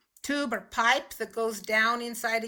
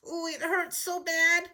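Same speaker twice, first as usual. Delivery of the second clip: higher "ooh, it hurts so bad" in mock pain; 7 semitones higher than usual. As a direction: playact a smashed finger. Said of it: "pound your finger with a hammer".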